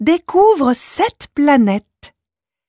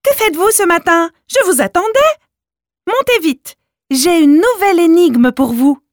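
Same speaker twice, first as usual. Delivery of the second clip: high, quick, eager squirrel voice; hurried; 10 semitones higher, 4 dB louder than usual. A narrator's reading. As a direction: rush it out, excited and urgent. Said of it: "leur dit Noisette pressée".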